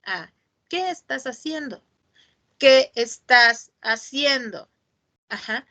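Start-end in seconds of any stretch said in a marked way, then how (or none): 0.7-1.8 soft and higher; a hushed, higher version of the question; soft take. Shown as a demonstration. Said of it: "No es lo mismo decir". 2.6-4.7 slow, drawn-out question; a slow, deliberate version of the question; slow take. Shown as a demonstration. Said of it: "No es lo mismo decir".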